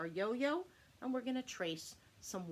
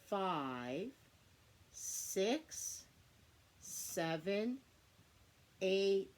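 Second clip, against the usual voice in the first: put-on kids show host; much slower than usual. Shown as a demonstration. Counting Y's aloud with child.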